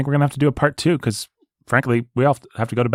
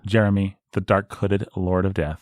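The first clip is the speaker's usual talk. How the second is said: lower, slowed dark-lord title; mock ominous announcement; 3 semitones lower than usual. Host hands death title to cohost, low mock ominous.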